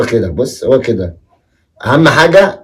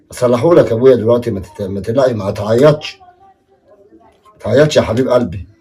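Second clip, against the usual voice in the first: soft, lower comforting; calm the crying boy gently; soothing; 6 semitones lower, 4 dB quieter than usual. Acted. Father soothing crying son. (طبطبة بصوت هادئ وواطي)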